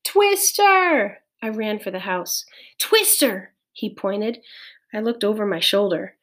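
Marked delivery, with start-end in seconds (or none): 0.0-1.2 drawn-out, high warning cry; yelled alarm across a yard. A narrator's reading. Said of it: "Pete yelled". 2.8-3.5 high, short warning shout; raised voice, pitched well up; yell. A narrator's reading. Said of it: "Pete yelled".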